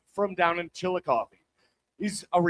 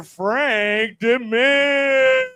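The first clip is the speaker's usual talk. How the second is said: hyped announcer; 4 semitones higher, 5 dB louder, much slower than usual. Acted. Host hypes the comic's name.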